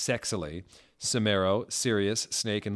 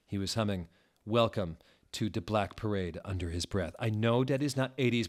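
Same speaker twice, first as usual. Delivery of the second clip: ordinary delivery, nothing marked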